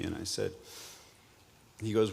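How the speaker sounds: usual speaking voice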